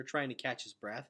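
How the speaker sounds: usual speaking voice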